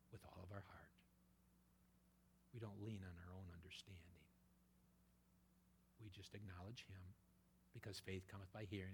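soft, hushed reassurance; preacher comforting the congregation in a soft whisper; intimate whisper. Acted. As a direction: lean in and whisper it to each listener. Preacher drops to intimate hush.

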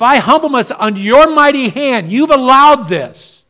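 loud, high, fiery pulpit preaching; full-force preaching. Acted. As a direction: preach it out hard to the congregation.

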